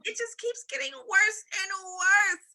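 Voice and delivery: slow, loud tearful wail; sobbing. A narrator's reading. Mother despairing over Camilla, sobbing loudly. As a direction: wail it through sobs. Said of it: "She began to sob".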